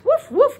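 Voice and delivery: high, loud playful barking; puppy woof. Pretend. Teacher voicing the toy puppy, high and loud. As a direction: bark like a happy little puppy. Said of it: "My puppy says".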